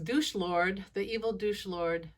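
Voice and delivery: normal talking voice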